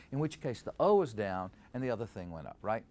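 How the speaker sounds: usual speaking voice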